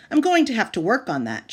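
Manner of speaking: plain reading voice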